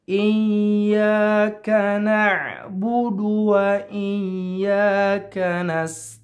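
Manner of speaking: slow melodic chanting of Iyyaka na'budu wa; Quran recitation. Acted. Teacher reciting the verse for students, slow chant. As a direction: chant it like tajwid recitation, drawn out.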